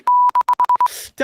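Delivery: high squealing laugh; loud burst of laughter. Real, not acted. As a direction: crack up loudly mid-insult. After mock insult.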